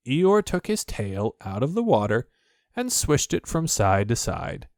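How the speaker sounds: ordinary delivery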